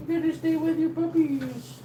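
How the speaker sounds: very high, sing-song pet voice. Real, not acted. Owner coaxing the mother dog in a high baby voice.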